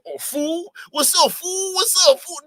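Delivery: exaggerated mock Chicano slang voice; mocking Mexican accent. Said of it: "Mexicans don't".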